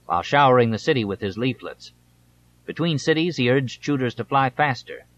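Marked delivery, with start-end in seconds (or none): none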